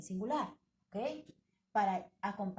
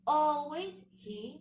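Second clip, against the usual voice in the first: "Always" said very slowly and much louder, higher; stress on always; 6 semitones higher, 9 dB louder, much slower than usual. Shown as a demonstration. Teacher hammering a grammar rule home to students, emphatic stress. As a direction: drum the word "Always" into them.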